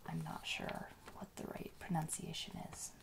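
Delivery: ordinary delivery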